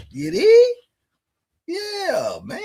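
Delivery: squeaky high yelled question; high mock shout. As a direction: yelp it way up high. Warning viewers to keep quiet.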